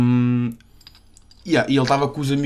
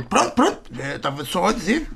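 higher, meek backing down; sheepish old man; 6 semitones higher, 3 dB quieter than usual. Retold or imitated. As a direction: retreat like a scolded old man.

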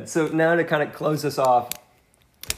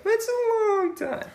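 much higher, slow and stretched out; silly high repeat; slower than usual. Shown as a demonstration. Friend echoing about their long friendship, in a silly high stretched voice. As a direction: squeak "That's a long time." way up high, drawn out. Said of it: "repeats it in a silly voice".

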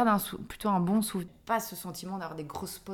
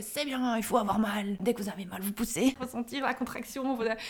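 lightly voiced urging doctor; gynecologist coaching; 5 semitones higher than usual. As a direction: coach the pushing, as in a retold scene. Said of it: "mon gynéco disait". (gynéco imité qui encourage à pousser)

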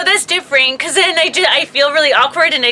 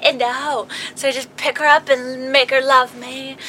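normal talking voice

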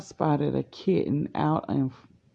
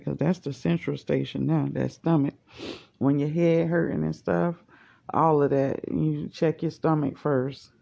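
ordinary talk, nothing marked

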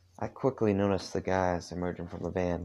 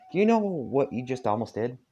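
put-on angry Southern accent; 5 semitones higher, 4 dB louder than usual. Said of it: "actually do the accent".

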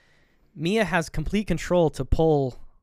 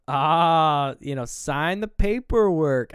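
louder, higher and slow; drawn-out retelling; 3 semitones higher, 5 dB louder, much slower than usual. Retold or imitated. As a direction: stretch it out with mock flourish. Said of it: "I like how that was said".

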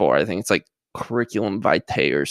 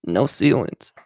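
[name]; 6 semitones higher than usual. Acted. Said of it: "[name] fans right there".

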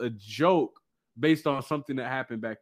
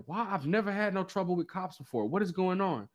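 puzzled protest; 5 semitones higher, 7 dB quieter than usual. Retold or imitated. Retold bewilderment at police stops.

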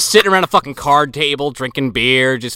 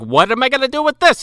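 mock Mulligan; 10 semitones higher than usual. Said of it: "And Mulligan's like".

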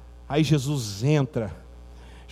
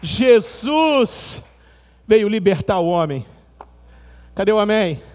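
higher, louder preacher call to praise; fervent preaching; 9 semitones higher, 6 dB louder than usual. Acted. Preacher calling the church to shout glory, fervent and loud. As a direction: rouse the church to cry out glory.

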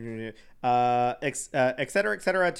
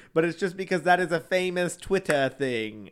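ordinary delivery

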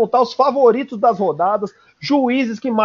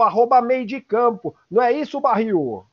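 ordinary talk

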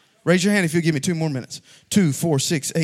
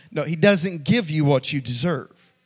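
usual speaking voice

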